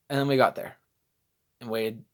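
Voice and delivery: ordinary talk, nothing marked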